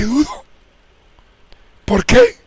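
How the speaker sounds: high, loud, baffled question; sudden emphatic outburst of bafflement; incredulous self-questioning. Retold or imitated. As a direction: throw the question up in disbelief. Questions own doubt, emphatically.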